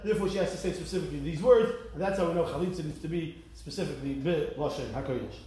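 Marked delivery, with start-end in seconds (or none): none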